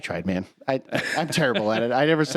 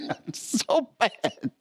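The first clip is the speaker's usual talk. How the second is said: ordinary talk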